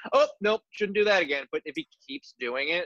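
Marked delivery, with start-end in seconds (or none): 0.1-1.5 higher, mock-sheepish retreat; lightly acted oops moment; imagined backing off. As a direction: act out catching your own mistake. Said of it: "if he was earnestly joking around, he'd be like".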